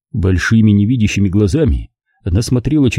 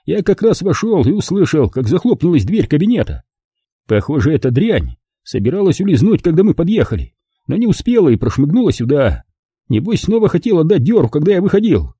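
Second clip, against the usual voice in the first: высокий голос пострадавшего инспектора; рассказывай высоко, с досадой на нападавшую; взбудораженный раненый инспектор; 7 semitones higher than usual. A narrator's reading. (инспектор жалуется высоким голосом)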